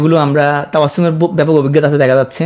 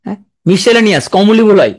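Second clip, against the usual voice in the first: ordinary delivery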